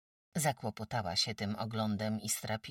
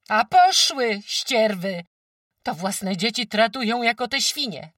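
much louder, higher angry shout; shouting at geese; 12 semitones higher, 15 dB louder than usual. A narrator's reading. Farmwife yells at the geese trampling goslings, furious shout. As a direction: chase the geese off with a yell. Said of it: "krzyknęła naraz na gęsi".

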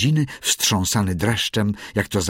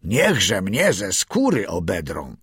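ordinary delivery, nothing marked

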